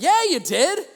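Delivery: high, loud, indignant insistence; indignant retort. Retold or imitated. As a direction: throw it back at him, outraged.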